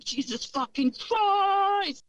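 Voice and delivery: high, drawn-out mock scream; imitated yell, held long and high but not full volume; retold yell. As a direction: stretch it out like someone yelling wildly. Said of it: "started yelling".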